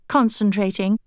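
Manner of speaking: usual speaking voice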